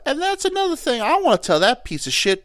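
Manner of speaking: high-pitched mock aunt tirade; indignant aunt. Aunt jumping out of the coffin, indignant. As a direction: pipe up high as an outraged aunt. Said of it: "jump out of the coffin and be like".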